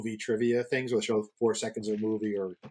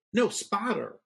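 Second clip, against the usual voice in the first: put-on drawls spotter with a thick Southern accent; imitating a colleague's thick Southern accent; correcting "spotter" in a broad Southern accent; 8 semitones higher, much slower than usual. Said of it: "he had the thickest Southern accent".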